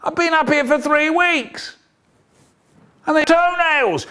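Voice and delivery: high-pitched mock complaint; wailing schoolchild. Pupil strung up from the wall bars, high plaintive wail. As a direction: wail high like an aggrieved little kid.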